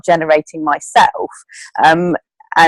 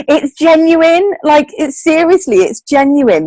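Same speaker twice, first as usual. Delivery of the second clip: high, drawn-out, earnest insistence; real emotion lifting the voice high; getting emotional; 8 semitones higher than usual. Real, not acted. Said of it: "I'm getting emotional".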